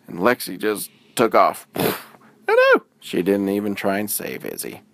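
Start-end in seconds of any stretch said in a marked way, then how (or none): 2.5-2.8 high, loud oh no; dismayed cry. A narrator's reading. Narrator reacting as Lexi leaves Izzy, high and loud. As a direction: gasp it out in outrage.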